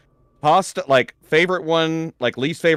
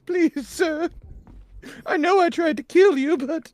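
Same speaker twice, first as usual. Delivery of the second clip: high, whiny begging robot; pleading robot voice; 12 semitones higher than usual. Pretend. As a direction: beg for mercy like a scared robot. Voicing the cowering robot begging.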